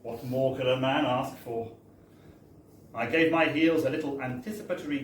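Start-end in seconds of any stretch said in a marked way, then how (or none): none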